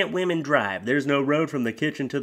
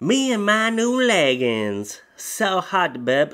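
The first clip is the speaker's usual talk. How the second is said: higher, slow put-on voice; hammed-up ditzy coo; ditzy poster; 5 semitones higher, 5 dB louder, slower than usual. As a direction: coo it like a vain influencer. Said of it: "reading the post".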